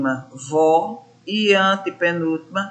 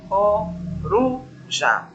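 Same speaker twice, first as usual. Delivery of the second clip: "coruja" slowly split, up on "ru", down on "ja"; "coruja" drawn out, falling away on "ja"; rise then drop; much slower than usual. Shown as a demonstration. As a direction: say "coruja" in syllables, stressing "ru". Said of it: "Aí desci".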